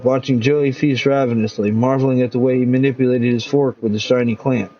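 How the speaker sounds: ordinary delivery, nothing marked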